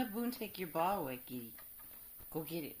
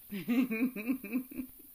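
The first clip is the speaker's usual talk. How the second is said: laugh; 9 dB louder than usual. Real, not acted. Laughing at scared dogs.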